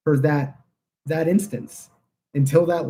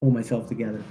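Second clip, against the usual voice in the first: regaining composure; 4 semitones lower, 5 dB quieter, much faster than usual. Real, not acted. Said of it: "sorry, let me".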